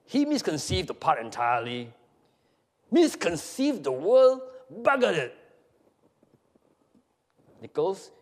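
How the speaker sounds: high, loud, mounting disgust; theatrical incredulous tirade; scornful Satan figure. Acted. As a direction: spit out contempt for giving in. Nichols rails at Job's surrender.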